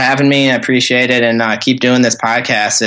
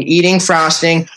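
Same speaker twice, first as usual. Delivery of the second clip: ordinary talk, nothing marked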